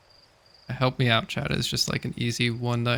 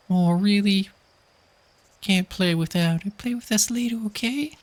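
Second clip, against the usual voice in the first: put-on much higher kid pleading to play; high child voice; 10 semitones higher than usual. Kid asking the astronaut to play hide and seek, high pleading child voice. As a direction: beg sweetly like a small kid wanting a game. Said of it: "I don't know why I can't do the voice".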